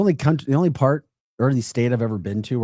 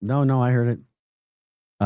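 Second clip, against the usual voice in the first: ordinary delivery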